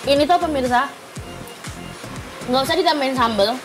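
ordinary talk, nothing marked

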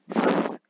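S đọc như tiếng xì /s/ nhẹ; ending s sound. Shown as a demonstration. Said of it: "Cái đầu tiên ha". (âm s vô thanh)

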